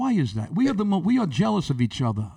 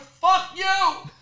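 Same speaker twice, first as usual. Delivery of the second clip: high, drawn-out retort; angry comeback; 11 semitones higher than usual. Acted. Comic snapping back at the lady, high angry retort. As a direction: fling the curse back, pitched way up.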